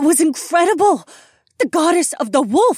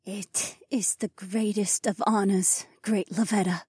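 near whisper; 10 semitones higher, 6 dB quieter than usual. A narrator's reading. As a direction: speak in hushed awe to a goddess. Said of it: "Mila nearly whispered".

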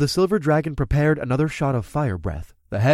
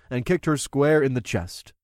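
ordinary delivery, nothing marked

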